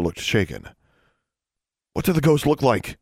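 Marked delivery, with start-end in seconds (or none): 1.9-3.0 higher, demanding question. A narrator's reading. Girl questioning the shaken witness, demanding. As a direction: press for an answer, pitch raised. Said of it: "she demanded".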